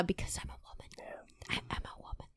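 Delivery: breathy whispered aside. Real, not acted. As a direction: drop to a secret whisper. Whispered aside about sexism.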